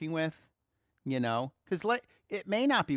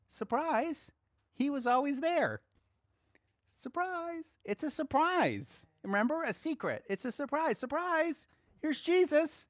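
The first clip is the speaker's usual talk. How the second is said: playful surprise; 11 semitones higher than usual. Acted. Playful surprise reveal, high pitch.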